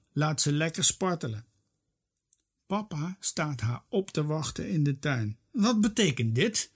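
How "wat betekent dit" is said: strict. A narrator's reading. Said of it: "zegt hij streng".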